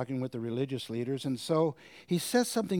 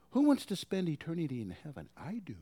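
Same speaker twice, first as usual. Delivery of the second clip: ordinary talk, nothing marked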